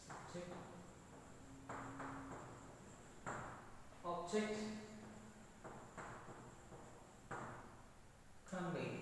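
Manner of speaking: soft isolated words with long gaps; softly name each word as you write; talking while writing. Real, not acted. Writing the same words again.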